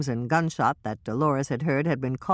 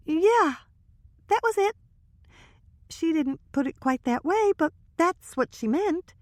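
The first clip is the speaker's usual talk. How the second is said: put-on higher young woman's voice, hushed gossip; Trisha's voice. Trisha confiding to Hannah and Michelle, higher nervous voice.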